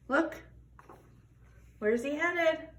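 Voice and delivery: ordinary delivery, nothing marked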